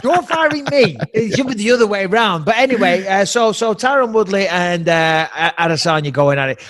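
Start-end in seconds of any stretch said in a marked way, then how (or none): 0.0-1.0 mock outrage. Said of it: "I'd be like".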